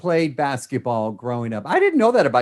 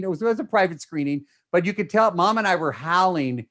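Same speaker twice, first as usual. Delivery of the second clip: normal talking voice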